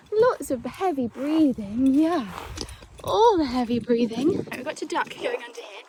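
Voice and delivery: ordinary talk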